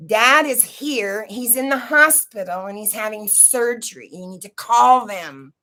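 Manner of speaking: put-on insistent kid pounding on the door; urgent child. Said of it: "I go bang on her door and I said".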